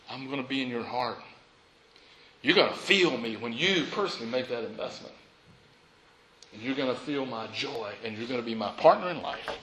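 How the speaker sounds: soft, low and intimate as Jesus; Jesus speaking tenderly. Acted. Pastor voicing Jesus to the congregation, soft and tender. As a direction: hush it down like a promise whispered to the heart. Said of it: "Jesus says".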